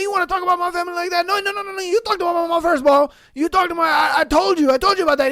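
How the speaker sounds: high, rapid-fire ranting; mock quarrel. Cooks arguing about family, mock angry and fast. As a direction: tumble through a hammed-up argument, fast and high.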